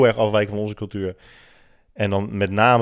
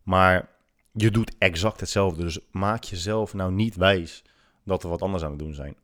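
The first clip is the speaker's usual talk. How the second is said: normal talking voice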